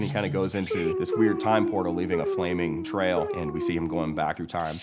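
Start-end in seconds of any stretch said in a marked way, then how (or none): none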